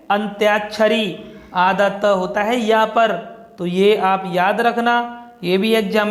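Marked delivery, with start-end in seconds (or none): 0.1-1.2 अंत्याक्षरी said clearly as "ant-yaak-sha-ri" with the y sounded; correct pronunciation. Shown as a demonstration. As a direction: pronounce अंत्याक्षरी with a clear य after अंत. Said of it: "क्या होता है?". (अंत्याक्षरी का शुद्ध उच्चारण)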